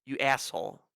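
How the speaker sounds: normal talking voice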